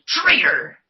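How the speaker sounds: angry shout. Retold or imitated. Stormtrooper yelling at Finn.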